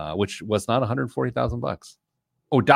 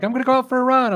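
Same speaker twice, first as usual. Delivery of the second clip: high voice wife; 14 semitones higher, 6 dB louder, faster than usual. Retold or imitated. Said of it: "she's like".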